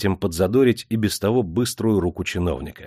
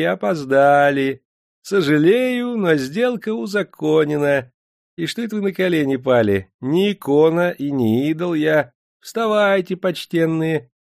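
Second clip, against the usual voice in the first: sweet ingratiating voice; 8 semitones higher, 3 dB louder than usual. A narrator's reading. Official coldly soothing the Bashkirs, high sugary voice. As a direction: sweet-talk them while refusing. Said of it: "вкрадчивым сладким голосом".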